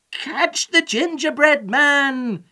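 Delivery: high, loud, drawn-out command; shouting. A narrator's reading. Old man to the pigs, shouting. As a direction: shout it slowly and urgently. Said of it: "shouted the old man to the pigs".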